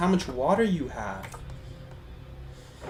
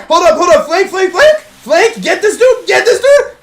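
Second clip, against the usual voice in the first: frantic shouting; 14 semitones higher, 13 dB louder than usual. Real, not acted. Intense fight, calling out.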